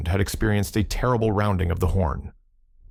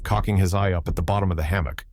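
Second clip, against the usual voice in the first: plain reading voice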